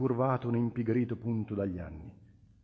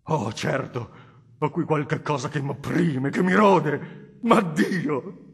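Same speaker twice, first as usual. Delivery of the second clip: loud, higher, tormented admission; anguished cry; 4 semitones higher, 11 dB louder, slower than usual. Acted. The nobleman confesses his torment to the cardinal in a loud anguished cry. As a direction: wring it out of a burning heart.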